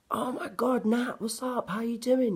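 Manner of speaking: very high, delighted squeal of welcome; high, loud, overjoyed hello; excited greeting. Retold or imitated. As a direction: mimic old friends thrilled to see you. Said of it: "And it's like".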